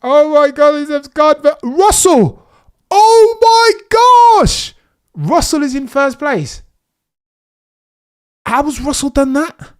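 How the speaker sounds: shocked streamer yelling at the first corner; excited shouting. Real, not acted. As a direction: scream it as the overtake happens. Race start, shocked by overtake.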